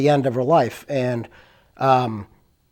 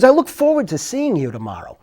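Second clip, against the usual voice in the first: high-pitched imitation of a clear-voiced woman; imitated polite caller; 6 semitones higher than usual. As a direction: mimic a polite caller from the past, pitched well up. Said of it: "the most clearest voice".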